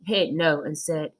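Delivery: ordinary delivery, nothing marked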